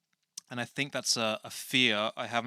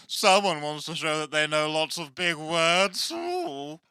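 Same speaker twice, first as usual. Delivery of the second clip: slow, high, loud sing-out jeer; teasing mockery; 6 semitones higher, 5 dB louder, slower than usual. Character teases host over big words, drawn-out high mockery. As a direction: stretch it out mockingly, ending on a very high ooh.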